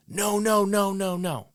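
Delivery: high, loud mock scolding "no"; scolding the dog. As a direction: nag the dog like a fussing owner.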